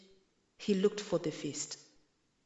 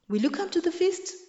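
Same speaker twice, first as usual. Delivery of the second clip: eager invitation; 10 semitones higher, 5 dB louder, faster than usual. A narrator's reading. Mwiti invites Kyundu eagerly.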